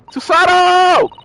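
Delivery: drawn-out high shout; loud, high, stretched call. Acted. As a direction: holler the name out long and loud. Calls out a game character's name.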